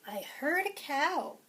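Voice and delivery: lively, sing-song picture-book read-aloud; reading to children. Acted. Teacher reading a picture book to preschoolers, lively and sing-song. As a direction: read it to a class of little ones.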